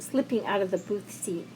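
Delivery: ordinary delivery, nothing marked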